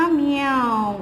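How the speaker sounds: drawn-out, falling "म्याऊ"; long demonstrated meow; cat meow imitation. Shown as a demonstration. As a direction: stretch it like a cat's meow. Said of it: "उसका sound क्या होगा".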